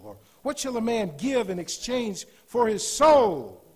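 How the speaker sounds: loud, high scripture climax; preaching at full force. Acted. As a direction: drive the question home at full voice.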